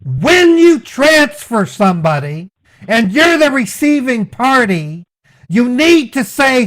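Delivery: ordinary talk, nothing marked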